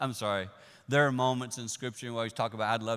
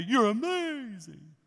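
slow, high, louder swoon; hammed-up starry-eyed praise; gushing awe; 10 semitones higher, 6 dB louder, much slower than usual. Disciples fawning over Jesus, slow and high. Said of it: "Going from screaming at him to".

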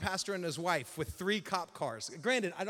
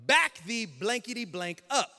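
slow, louder, emphatic command; forceful order; 2 semitones higher, 6 dB louder, slower than usual. Retold or imitated. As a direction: spell out the order word by word. Officer's order, swearing self-censored.

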